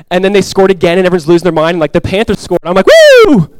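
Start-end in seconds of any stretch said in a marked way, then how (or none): 2.9-3.6 high, loud "woo!"; big reenacted crowd cheer; celebratory whoop. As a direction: whoop like a lone fan at a goal. Imitates cheering the Panthers' goal.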